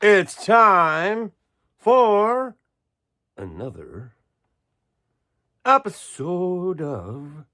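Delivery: dramatic show announcer, drawn out; hammed-up announcer voice with a slow title; announcer intro. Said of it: "You like that?".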